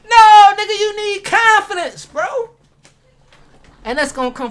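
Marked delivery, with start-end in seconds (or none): 0.1-2.5 loud, high hyped yell; hyped shout. Real, not acted. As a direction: fire yourself up, shouting it out. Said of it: "I get hyped about this".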